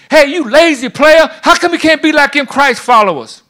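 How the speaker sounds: high, full-throated coach's yell; shouting football coach. Acted. Football coach scolding a lazy player, high hard shout. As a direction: bawl out a lazy player at the top of your voice. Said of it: "Why can't the football team and mil".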